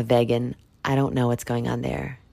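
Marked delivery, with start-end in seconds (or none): 0.0-0.5 "vegan" said as VEG-an; first syllable of "vegan" like "veg"; imitated pronunciation. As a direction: say "vegan" with a short first e, as she does. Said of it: "She calls herself a vegan".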